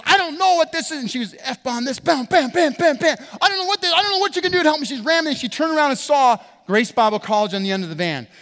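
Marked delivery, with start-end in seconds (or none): 0.1-1.0 high, loud flustered wail; mock flustered woman. Preacher voicing the cursing woman at the pump, high and flustered. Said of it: "She goes". 2.0-3.2 mock ramming noises. Imitating the pump ramming. 3.4-4.8 high, loud frantic wail; mock frantic woman. Preacher voicing the stranger begging for help, high and frantic. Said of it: "She goes".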